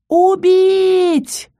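high, long held shout; wailing shout. A narrator's reading. Count ordering the undead to attack, high shout. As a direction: scream the order, stretching the vowel. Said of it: "по пути заголосив".

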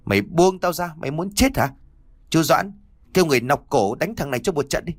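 high, sharp angry shout; shouting angrily. Acted. Lady of the house shouting at the boy to let go, high and angry. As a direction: order the beating, furious. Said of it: "trợn mắt quát".

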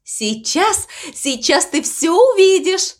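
high, lilting cow announcing her show; eager announcement. A narrator's reading. Cow promising the crow a ballet, eager announcement. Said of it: "объявила мама Му".

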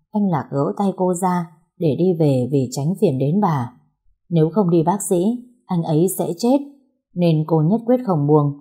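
plain reading voice

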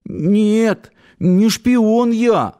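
high, slow pleading whine; pitiful high-pitched denial; plaintive bleat. A narrator's reading. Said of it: "жалобно проблеял".

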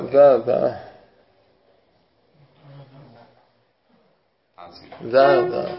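ذا said loud with a long aa vowel; corrective model. Shown as a demonstration. Teacher correcting the student's reading, loud corrective ذا. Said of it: "قلت ذو".

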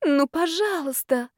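high, slow pleading groan; sincere moaning plea. A narrator's reading. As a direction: beg for mercy with a moan. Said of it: "простонала я совершенно искренне".

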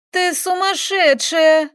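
high, drawn-out shriek of protest; outraged cry. A narrator's reading. Girl to the woman attacking her, outraged cry.

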